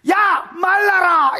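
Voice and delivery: acted shouted rebuke, high and drawn out; rebuking command. Preacher as God rebuking the Red Sea, shouted command. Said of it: "꾸짖어 홍해를 말랐다 안 하나".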